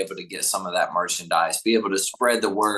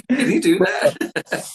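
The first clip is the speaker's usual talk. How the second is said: high, loud, slow through laughter; real laughter breaking through the words; laughing; 10 semitones higher, 5 dB louder, slower than usual. Real, not acted. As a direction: repeat the punchline while cracking up. Host laughs, repeating the punchline.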